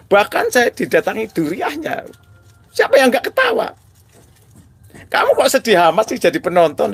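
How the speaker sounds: high, exultant boasting; gleeful high voice. Real, not acted. Telling viewers he is free now, gleeful and high. Excited preaching and thanking viewers.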